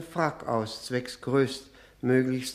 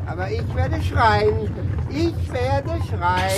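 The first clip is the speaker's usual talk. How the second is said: put-on loud, higher, slow drunk wailing; drunken defiance; 8 semitones higher, 8 dB louder than usual. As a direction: threaten to scream like a stubborn drunk. Drunk threatening to scream.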